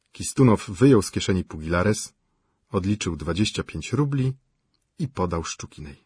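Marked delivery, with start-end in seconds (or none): none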